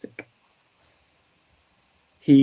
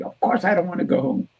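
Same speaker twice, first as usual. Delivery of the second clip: mock yelling; 7 semitones higher, faster than usual. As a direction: imitate an indignant client yelling back, half-hearted. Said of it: "he's, like, yelling at me".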